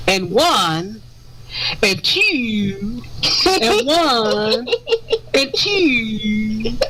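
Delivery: drawn-out count, swooping on each number; Count Dracula. Retold or imitated. Host mimicking an officer from YouTube, Count Dracula counting. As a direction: count slowly like a cartoon vampire, breaking into laughs. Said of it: "that sounds like Count Dracula".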